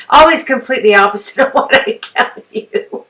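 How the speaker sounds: laughing at herself mid-sentence. Real, not acted. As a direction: giggle through the words. Laughs at her own mistake.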